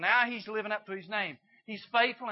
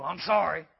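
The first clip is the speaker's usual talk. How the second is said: tossed-off sorry, louder and curt, not meant; dismissive fake apology; 4 semitones lower, 5 dB louder than usual. Shown as a demonstration. Preacher mocking a kid who won't apologize properly, insincere. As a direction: throw it away like a grudging kid. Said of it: "Go in there and mean it".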